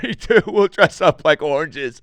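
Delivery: high, loud mock-tearful wail; hammed-up crying agreement; choked up. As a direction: act overcome, squeezing the words out high. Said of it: "that got me really choked up".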